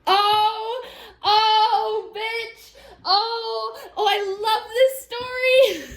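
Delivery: high, squealing, tearful delight. Real, not acted. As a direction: squeal with glee at the story. Delighted reaction to comeback.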